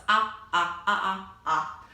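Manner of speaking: ah repeated short; teacher models the short O for octopus, ah; short O sound. Shown as a demonstration. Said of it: "short O, like octopus".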